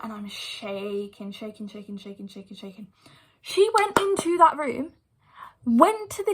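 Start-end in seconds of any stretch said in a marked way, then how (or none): 0.0-2.9 trailing off into near silence; fading out. Retold or imitated. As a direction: let each "shaking" sink quieter until it nearly vanishes. Recalling trembling before the teacher.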